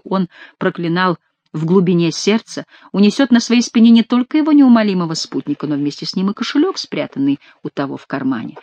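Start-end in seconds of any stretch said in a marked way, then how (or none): none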